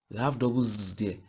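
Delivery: normal talking voice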